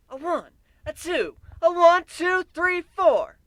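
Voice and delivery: high, loud count-in building up; counting in the band. Acted. Counting in the band before the song, building to a high shout. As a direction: shout the count like a rock show.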